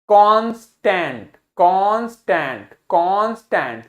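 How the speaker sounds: slow "constant" in two stretched beats; syllable demonstration. Shown as a demonstration. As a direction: draw out "constant" as कांस–टेंट, one chin drop each. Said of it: "चिन जितनी बार नीचे आएगी उतने syllable".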